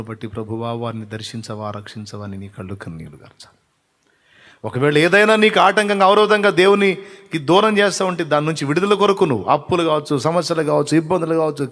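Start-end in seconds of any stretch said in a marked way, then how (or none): 4.6-11.7 higher, louder preaching at full force; fervent preaching. Acted. Preacher urging the congregation at full force. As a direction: drive the point home to the congregation.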